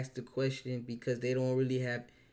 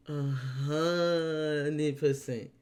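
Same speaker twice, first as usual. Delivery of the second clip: slow drawn-out; much slower than usual. Real, not acted.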